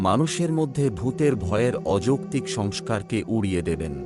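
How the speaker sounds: ordinary delivery, nothing marked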